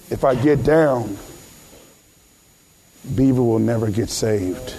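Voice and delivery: low, level, solemn voice with long pauses; solemn preaching. Acted. Preacher tells members Jesus saw them, low and solemn. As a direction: let each phrase land in silence. Imagines Jesus thinking on the cross.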